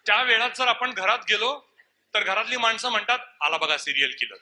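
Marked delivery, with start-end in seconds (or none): 3.4-4.3 low, loud, deadpan announcement; dry family jibe. Acted. Family at home greeting the latecomer during their serial, sardonic. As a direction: drop the pitch and announce the unwelcome arrival.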